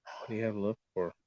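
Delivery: a quiet, brooding question; hushed question. Acted. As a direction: ask it under your breath. Same question hushed then yelled.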